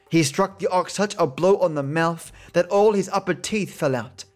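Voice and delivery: ordinary delivery, nothing marked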